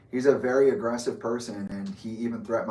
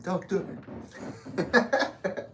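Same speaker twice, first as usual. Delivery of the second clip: mock whisper; 7 semitones higher, much slower than usual. Riot cop restraining you.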